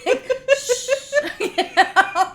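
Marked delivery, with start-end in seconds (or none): 0.6-0.9 loud, laughing "shh"; long mock shushing noise; exaggerated shush. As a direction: hush someone comically. Retold hushing of the husband.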